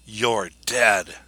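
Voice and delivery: a flat, menacing "you're dead"; cold killer voice. Retold or imitated. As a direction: say it like a killer standing over a body. Killer's words in retold recording.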